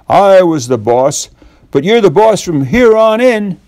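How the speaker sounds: a mildly shaky, nervous reply. A narrator's reading. Huge cowboy yields to Bill, mildly shaky voice. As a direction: giant cowboy giving in, a touch of tremble. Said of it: "said in a shaky voice".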